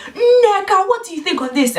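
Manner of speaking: put-on teacher singling her out, loud and high in a Nigerian accent; teacher's mock accent. Supply teacher singling out the pupil in a loud, laughing Nigerian accent. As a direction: call "Nneka" out loud with a laugh. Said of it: "supply teacher had it in for me".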